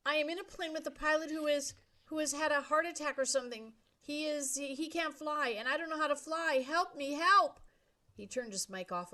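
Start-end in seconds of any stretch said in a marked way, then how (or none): 0.1-7.6 high, loud desperate plea; desperate pleading. A narrator's reading. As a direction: beg for help in panic. Brian pleads over radio.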